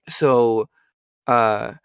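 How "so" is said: ordinary talk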